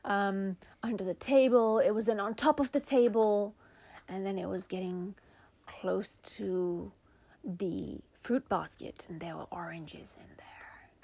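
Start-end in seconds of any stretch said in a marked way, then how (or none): 4.1-10.9 suspenseful whisper. A narrator's reading. Narrating the bunny sneaking to the fruit, whispered suspense. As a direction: creep toward the fruit basket.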